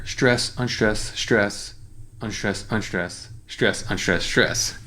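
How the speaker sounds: chanting "stress, unstress" with the stressed beats leaned on; counting stress pattern. Shown as a demonstration. Songwriting teacher reciting the line's stress pattern aloud. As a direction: tap out the rhythm word by word. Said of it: "So this first line here".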